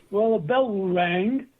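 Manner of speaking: normal talking voice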